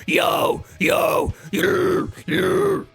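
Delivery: deepest growl on yo. Shown as a demonstration. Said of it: "you can go even deeper".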